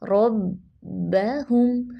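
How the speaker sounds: रब्बहुम very slow with heavy rolled रॉ and held doubled बा; slow tajweed. Shown as a demonstration. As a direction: stretch रब्बहुम, stop on the बा. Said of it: "मोटा".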